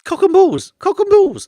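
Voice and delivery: very loud, high-pitched comic shout; comic yelling. Pretend. Reader bursts out mid-report, loud high comic yell. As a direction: yell it out as a daft outburst. Yells a joke phrase mid-reading.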